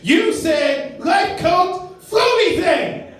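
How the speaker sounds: high, slow, shouted taunt; mocking callback shout. Mocks his friend again, high, slow and yelled. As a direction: stretch it out and yell it. Mimicking friend in every argument.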